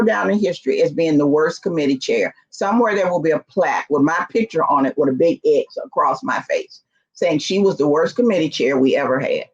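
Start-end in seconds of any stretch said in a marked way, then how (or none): none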